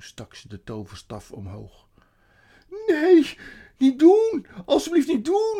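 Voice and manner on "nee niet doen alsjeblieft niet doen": high, very loud pleading scream; desperate shouting. A narrator's reading. Edmund begs the witch to stop, screaming. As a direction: cry out to stop her. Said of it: "schreeuwde Edmund".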